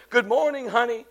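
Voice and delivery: soft, gentle morning greeting; tender mother waking baby. Acted. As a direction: coo softly to a sleeping child.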